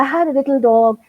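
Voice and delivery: very slow, lilting, acted-out children's poem; expressive poem recital. Shown as a demonstration. A little girl acting out her poem for the teacher, very slow and lilting. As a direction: tell the poem with feeling, drawing out each line. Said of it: "तो इस तरह से मैं बोलती".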